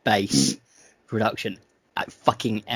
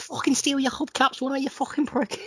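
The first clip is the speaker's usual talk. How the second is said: mock Scouse accent; 13 semitones higher, 3 dB quieter than usual. Podcast host mocks a rocker drifting into Scouse, hammed-up Scouse accent. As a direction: ham up a Liverpool Scouse hard man. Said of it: "I almost transitioned into a Scouse accent".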